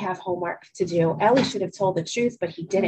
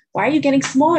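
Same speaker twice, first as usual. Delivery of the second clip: surprised; 5 semitones higher, 7 dB louder, faster than usual. A narrator's reading. Ellie surprised at shrinking lie.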